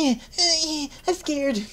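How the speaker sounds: high, whimpery mock-scared voice; hammed-up frightened little squeaks; scared girl voice. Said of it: "She's like".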